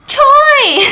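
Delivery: very high, very loud cry; shrieked name. Retold or imitated. The missing Choi walks in, high shrieked greeting. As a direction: shriek the name across the room.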